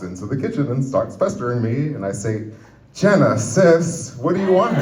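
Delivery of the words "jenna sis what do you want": drawn-out, higher, fed-up address; mock exasperation. Comic to pestering sister in the kitchen, mock exasperated. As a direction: act put-upon, stretching out the sister's name.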